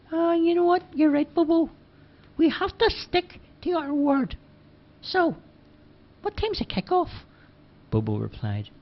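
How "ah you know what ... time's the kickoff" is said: put-on high boyish voice, pitched well up; Charlie's voice. A narrator's reading. Charlie agreeing with Bobo, high boyish voice. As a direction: play young Charlie, light and high. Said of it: "and then he spoke".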